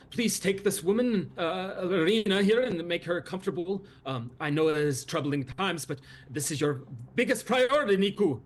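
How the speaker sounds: bustling host welcoming and calling out; eager Luvash. Acted.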